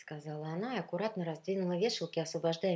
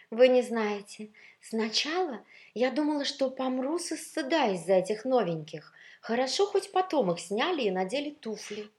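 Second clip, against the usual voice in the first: high, loud, breathless-with-news telling; animated, scandalized outpouring; gushing gossip; 7 semitones higher, 7 dB louder than usual. Acted. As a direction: confide a mortifying ordeal with drama. Shoes gossip excitedly about embarrassment.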